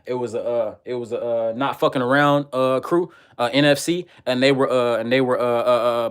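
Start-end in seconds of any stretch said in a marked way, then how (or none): none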